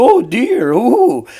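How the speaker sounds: high, loud "Oh dear, ooh"; startled woman. Retold or imitated. Imitating a woman startled by the sneeze, high. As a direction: gasp it like a startled lady. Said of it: "The woman was like".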